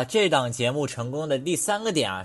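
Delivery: ordinary talk, nothing marked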